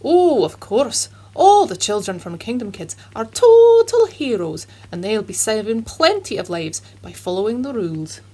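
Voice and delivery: put-on proud Scottish puppet cheering the kids; Scottish accent. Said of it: "Scotch Jeremy".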